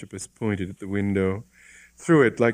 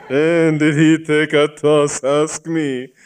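louder, higher, laughing through it; chuckling contentedly; 8 semitones higher, 9 dB louder, slower than usual. A narrator's reading. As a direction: chuckle with self-satisfied glee. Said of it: "chuckling contentedly".

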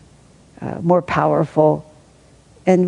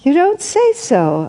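high, slow, mock-astonished cry of disbelief; sarcastic fake amazement; 8 semitones higher, slower than usual. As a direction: stretch it out in wide-eyed sarcastic surprise. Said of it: "I cried".

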